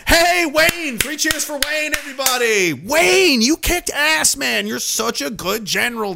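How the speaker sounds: hammed-up praise for General Wayne; sarcastic cheer. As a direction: cheer him on with biting sarcasm.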